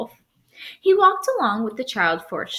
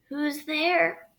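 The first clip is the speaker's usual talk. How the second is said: higher hoarse put-on croak; croaking wolf; 7 semitones higher than usual. Wolf disguised as grandmother croaks at the door. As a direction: croak it like a sick old woman. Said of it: "croaked the wolf".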